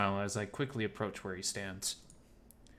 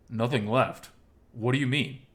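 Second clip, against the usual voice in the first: louder, startled questioning; taken aback; 7 dB louder than usual. Acted. Oswald questioning Miles about his loss, taken aback. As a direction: echo it back in disbelief.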